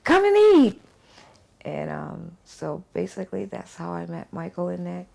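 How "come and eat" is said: high, loud call of a friendly neighbour; calling through the window. Retold or imitated. Neighbour calling her to dinner through the window, loud and high. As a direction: sing out an invitation to come and eat. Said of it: "and say".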